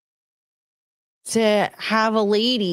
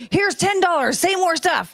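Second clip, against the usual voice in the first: eager caller; 9 semitones higher than usual. Retold or imitated. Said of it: "these dudes are like".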